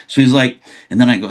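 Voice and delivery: normal talking voice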